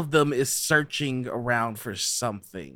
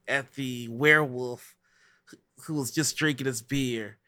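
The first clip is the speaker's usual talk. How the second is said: ordinary talk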